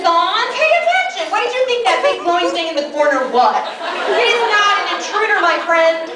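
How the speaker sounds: high, loud, mocking rebuke; exasperated scolding. Wife scolding her husband for mistaking the TV for an intruder. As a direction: scold him like a fool.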